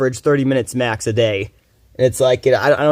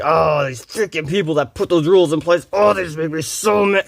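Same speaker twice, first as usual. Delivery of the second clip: mock whiny anger; 3 semitones higher than usual. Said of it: "mad about something like".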